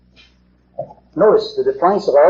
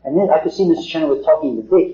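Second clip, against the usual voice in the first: ordinary talk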